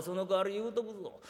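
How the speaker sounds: normal talking voice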